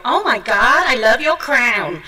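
gushing mock American, Oh my God drawn out; imitating a starstruck American fan; a light American accent, excited. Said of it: "I'm going to butcher people's accent now".

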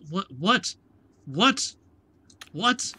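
high, rising, repeated yelps; stuck on one incredulous question; baffled disbelief. Real, not acted. Player baffled that waiting gave the wrong time, disbelieving.

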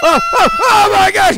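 loud, high frantic cry; panicked yelp. Acted. Boy panicking as the angels chase him. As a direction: flee in terror.

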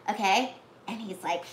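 usual speaking voice

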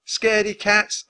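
loud, high jeer; high, triumphant taunt; taunting shout. A narrator's reading. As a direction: yell it after them mockingly. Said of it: "Annie shouted after them".

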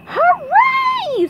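very high, loud cartoon cheer; SpongeBob shouting. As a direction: shriek a jubilant pipsqueak cheer. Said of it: "SpongeBob shouted".

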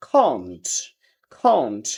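British "can't" with open AH, falling low; British accent. Shown as a demonstration. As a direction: say "can't" as KAHNT, British style. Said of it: "ব্রিটিশরা বলতেছে আ". (British উচ্চারণে)

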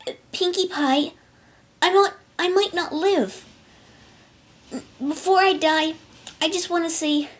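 high, hesitant, halting confession; Rainbow Dash. A narrator's reading. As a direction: falter through possible last words. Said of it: "she hesitated".

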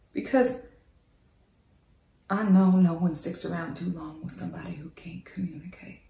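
hushed, intimate whisper. Acted. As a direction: barely breathe the words to him. Intimate confession to Wendell.

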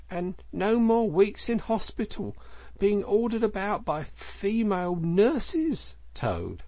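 high-pitched, pointed send-off, dropping on the name; admonishing Mole. Acted. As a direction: add one last gentle dig.

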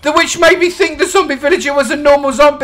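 high, loud cry of dismay; upset and annoyed. Real, not acted. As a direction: wail in disbelief at your own blunder. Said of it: "I'm really sad and annoyed".

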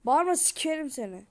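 loud furious yell at the dog; shouting at a barking dog, angry. Real, not acted. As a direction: snap at the barking dog to shut up.

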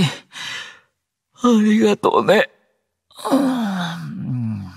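high, yawny thanks; thanks melting into a yawn; drowsy yawning. Acted. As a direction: drift off into a sleepy, high moan. Sleepy thanks before dozing off.

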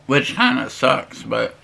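swallowing between words; talking while chewing. Real, not acted. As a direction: mouth partly full of food. Seen 2: Takes forkful at -5; lips pressed, chewing until ~3s, then wipes mouth.

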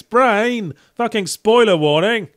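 mock-outraged telling-off; exasperated scolding. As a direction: scold your own brain for blabbing. Scolds his own brain for spoilers.

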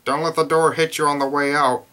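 loud parting jab. A narrator's reading. Grunkle Stan sending Dipper off, loud jab.

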